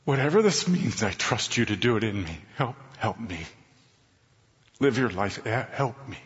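low, soft cry for help; pleading prayer. Retold or imitated. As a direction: murmur it like a last plea. Reenacted prayer, crying for help.

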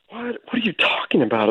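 a light stunned yelp of surprise; mild mock surprise; startled what. Retold shocked reaction.

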